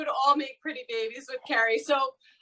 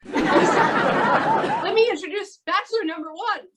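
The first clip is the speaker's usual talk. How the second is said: slow, louder drawn-out announcer intro; game show announcer; 6 semitones higher, 6 dB louder, slower than usual. Acted. Host presenting bachelor number one, drawn-out announcer style. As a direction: build up the contestant like a TV host.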